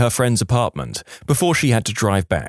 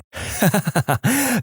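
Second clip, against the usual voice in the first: laughing; 10 semitones higher than usual. Real, not acted. Narrator laughs at Dutch voice.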